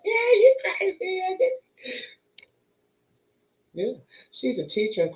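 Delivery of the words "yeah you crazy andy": high laughing yell; laughing shout. Retold or imitated. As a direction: crack up at the roommate. Laughing chat with roommate.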